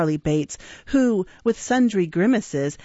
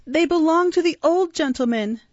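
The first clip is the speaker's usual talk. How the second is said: Oliver; 10 semitones higher than usual. Acted. Oliver pleads.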